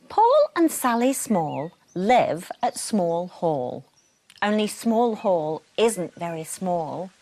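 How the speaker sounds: lively storybook reading with voiced characters; animated read-aloud for children; bedtime story narration. A narrator's reading. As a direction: read it like a bedtime book to little ones. Reading picture book to children.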